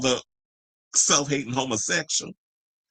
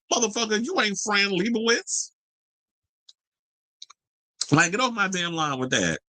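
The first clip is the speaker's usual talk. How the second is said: indignant; 9 semitones higher than usual. Real, not acted. Host scoffing at a photographer's prices, indignant. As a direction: scoff high at his inflated sense of himself.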